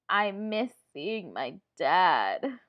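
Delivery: very slow, heavy sorrow; sad. Shown as a demonstration. Teacher acting out missing dad for pupils, sad. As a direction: draw the words out in loud, hammed-up sadness.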